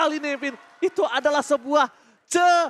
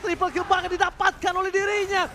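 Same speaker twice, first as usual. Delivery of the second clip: rising hype play-by-play, higher, louder; esports caster; 6 semitones higher, 3 dB louder than usual. Acted. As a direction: call the teamfight with building excitement. Caster calls a teamfight live.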